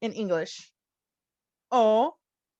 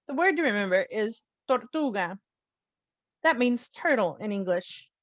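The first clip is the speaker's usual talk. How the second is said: ordinary talk, nothing marked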